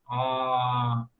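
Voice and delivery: ordinary talk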